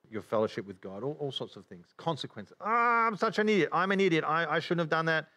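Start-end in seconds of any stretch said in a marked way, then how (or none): 2.6-5.3 high-pitched lamenting groan; mock self-reproach. Preacher imitating a sinner facing consequences, whining self-blame. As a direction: beat yourself up, hammed-up.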